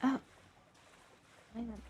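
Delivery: ordinary delivery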